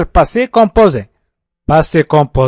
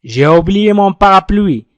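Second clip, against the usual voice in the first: J'ai oublié mon parapluie said slowly and clearly for echoing; slow repeat-after-me; 2 semitones higher, slower than usual. Shown as a demonstration. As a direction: lead the class to repeat. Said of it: "حاول أن تنطق معي الجمل". (المعلم يطلب من التلاميذ الترديد معه: نطق بطيء واضح)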